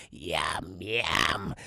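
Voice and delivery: very low monster voice; low gremlin-like eating voice; creature voice. Retold or imitated. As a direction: play a little monster, deep in the throat. Voicing the creature.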